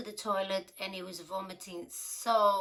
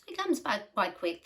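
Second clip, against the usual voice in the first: ordinary talk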